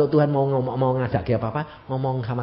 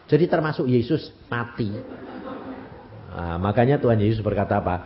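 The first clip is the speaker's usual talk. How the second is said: ordinary talk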